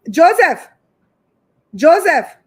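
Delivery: loud, high shout of a name; calling out. Real, not acted. Calling Joseph to come on the live, loud call. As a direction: holler for someone out of sight.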